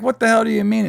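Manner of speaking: high-pitched mock outrage; retold indignant retort. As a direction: imitate an offended comeback, pitched high. Retold argument, Barbara snaps back.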